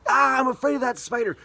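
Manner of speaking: high, loud hammed-up shriek; mock scream of fear. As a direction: shriek at the spider in mock terror. Acts a scared person.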